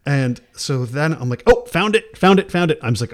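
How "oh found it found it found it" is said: high, loud burst of relief; acted-out excited scramble; flustered discovery. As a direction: blurt it out as if just spotting it. Acts out suddenly finding the app.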